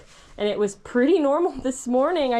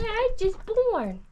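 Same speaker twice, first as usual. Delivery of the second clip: put-on high, slow baby-foal voice; 9 semitones higher, slower than usual. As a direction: pretend to be the newborn filly, high and slow. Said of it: "voicing the newborn foal".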